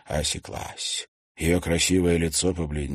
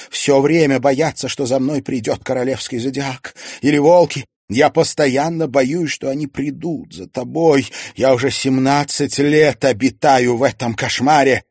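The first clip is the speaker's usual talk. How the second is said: loud, higher, fearful plea; anguished outpouring; 7 semitones higher, 8 dB louder than usual. A narrator's reading. Mother confessing years of dread to her son, anguished. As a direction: pour out the long-held fear.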